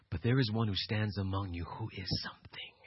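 hushed, low whisper of a secret; voicing John to the crowd, whispered and low. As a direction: breathe it like a revelation. Preacher hushes the key line.

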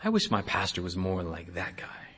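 low, hushed inner thought; private wish. Acted. Churchgoer secretly envies another church, private hushed wish. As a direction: wish it quietly to yourself. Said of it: "we've said in our hearts".

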